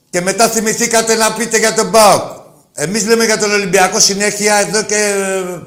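loud, heated tirade at rival fans; angry rant. Real, not acted. Radio host ranting loudly at PAOK fans on air. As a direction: hammer the fans with rising anger.

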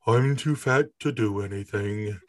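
slow, Eeyore-like cat voice; Vader the cat. Acted. Owner voicing his too-fat cat, slow Eeyore-style voice. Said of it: "Somehow Vader is combined with Eeyore".

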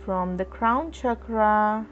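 usual speaking voice

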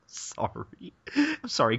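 ordinary talk, nothing marked

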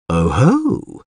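drawn-out delighted exclamation; intrigued, pleased "oh-ho"; cheered up. A narrator's reading. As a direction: brighten at a welcome discovery. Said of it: "The bard visibly cheered up".

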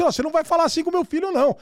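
fast, high indignant warning; father taking offence. Retold or imitated. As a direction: act out a dad bristling for his son. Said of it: "ele pega um ar naquele momento".

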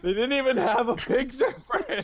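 high, laughing through words; giggling while talking. Real, not acted. Mocking the missing art.

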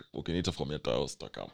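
ordinary talk

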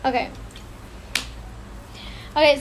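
normal talking voice

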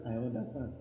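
low, soft and steady; calm answer. Acted. Comforter who lost his family answering a mourner, quiet and low.

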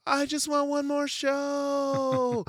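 high, drawn-out mock wail; crying toddler. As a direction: plead in a whiny, high child's cry. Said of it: "she's running into her room crying".

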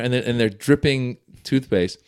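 usual speaking voice

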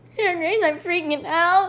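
panicked quote. Retold or imitated. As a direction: voice her friend on the edge of tears. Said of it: "her voice started wavering".

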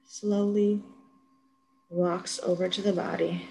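ordinary delivery

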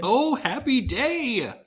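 slow, high, sung-out joy; drawn out like a celebration; mock joy. Real, not acted. Joyful exclamation, slow.

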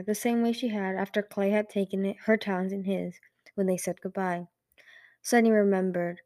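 ordinary delivery

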